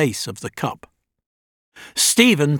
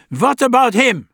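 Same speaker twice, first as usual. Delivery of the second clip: put-on German old man; 9 semitones higher, 4 dB louder than usual. A narrator's reading. Goldsmith worried.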